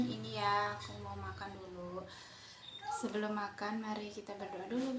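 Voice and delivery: ordinary delivery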